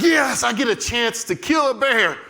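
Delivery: high-pitched eager outburst; excited. Acted. Storyteller as David, eager to kill a bear, excited. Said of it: "He gets all excited".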